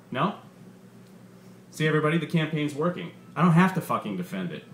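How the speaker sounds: lively, punchy comedian working the crowd; stand-up comedian. Acted. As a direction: sell the bit to a packed club. Comedian's stand-up set in story.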